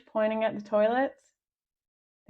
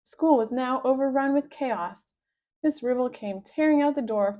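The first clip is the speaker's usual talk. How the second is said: ordinary delivery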